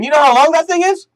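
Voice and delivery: outraged old senator, hammed up; mock indignant congressman. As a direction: play the offended old politician. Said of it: "He was upset".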